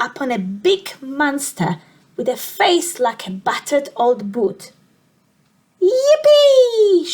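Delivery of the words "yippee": high, loud whoop of joy; delighted shout. Acted. George spotting the monster at last, shouting with high loud joy. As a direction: cry it out in triumph. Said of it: "shouted George".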